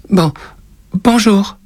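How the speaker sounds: put-on very high, stammered child's hello; small scared voice; frightened boy. A narrator's reading. Terrified boy stammers greeting to witch.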